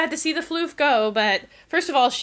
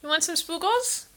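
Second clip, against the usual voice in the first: higher pet-talk coo; 6 semitones higher than usual. Real, not acted. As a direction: coo it to the cat, pitched up. Baby talk to cat.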